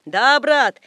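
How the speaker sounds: high, loud heckle; shouted agreement. A narrator's reading. Spectators at the back agree with Napoleon, shouted agreement. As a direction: call it from the back rows. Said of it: "кричали сзади".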